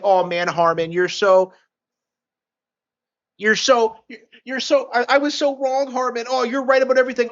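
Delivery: high, whiny-pleading mock concession; mock admission. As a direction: put on an exaggerated, high-pitched grovelling apology.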